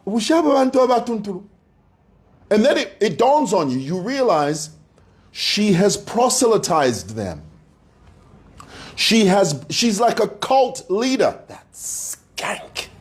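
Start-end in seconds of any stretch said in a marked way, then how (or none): 0.0-1.4 fast, high Bemba aside; exasperated inner thought. Retold or imitated. Viewer reading sick comments, fast high exasperated Bemba aside. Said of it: "you think to yourself". 11.5-12.9 breathy whispered jab under the breath; whispered insult. Acted. Ranting about Lily, whispered insult aside.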